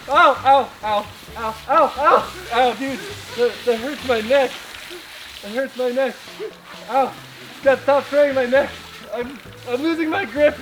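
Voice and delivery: loud ows from the cold spray; pained yelling. Real, not acted. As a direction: cry out in real pain. Neck sprayed, hanging on.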